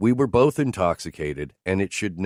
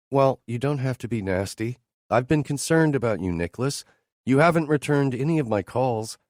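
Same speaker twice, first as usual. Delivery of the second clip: higher, lightly voiced hurt woman; woman complaining to her boss, a little higher; Teresa's voice; 6 semitones higher than usual. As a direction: play the wounded colleague, a bit higher. Teresa speaks.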